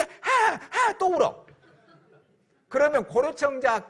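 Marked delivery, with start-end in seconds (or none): 0.0-1.0 higher drawn-out mock cry; hammed-up child's wail. Son crying again at dawn over the vase, mock wail.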